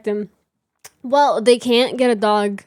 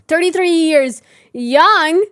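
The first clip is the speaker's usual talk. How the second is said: louder, higher and slower, cheeky; playful boast; 4 semitones higher, 6 dB louder, slower than usual. Real, not acted. Joking to chat about her age, loud and cheeky. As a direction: sell it like a proud joke.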